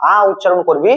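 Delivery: usual speaking voice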